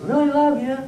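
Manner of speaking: high-pitched call from the sidelines; acted cheer from a distance; mock yelling. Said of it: "stand on the sidelines with somebody and yell".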